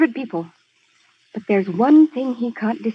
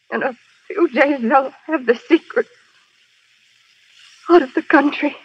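dying words. Acted. Stabbed woman gasping her last words.

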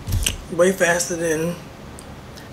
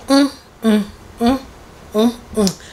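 savoring mm; 5 semitones higher than usual. Real, not acted. Tasting the smoothie bowl.

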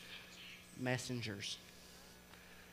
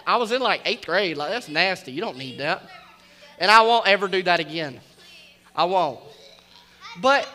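usual speaking voice

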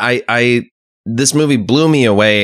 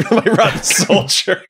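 laughing; 8 semitones higher than usual. Real, not acted. Repeating a joke while laughing.

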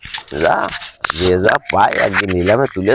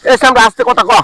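much higher, louder reprimand; sharp rebuke; 12 semitones higher, 5 dB louder than usual. Acted. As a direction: cut in loudly on a raised pitch. Cuts off noisy complainer.

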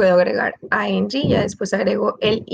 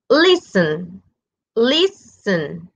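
teacher's demo of "Listen", syllables pulled apart, first one stressed; 2 semitones lower, much slower than usual. Shown as a demonstration. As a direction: hit the start of "Listen", let the ending fall. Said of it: "tiene su stress at the beginning".

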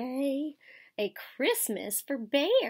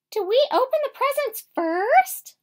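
high, loud eager squeak; little mouse eagerly asking; 13 semitones higher, 8 dB louder than usual. A narrator's reading. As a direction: ask it like you can't wait. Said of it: "Mouse asked eagerly".

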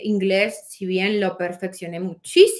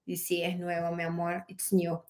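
quiet aside; 2 semitones lower, 9 dB quieter than usual. Real, not acted. Aside to husband in the room.

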